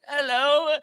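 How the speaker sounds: put-on high, thin little old lady voice; a lightly voiced sweet old lady greeting; old person voice. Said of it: "that's my old person voice".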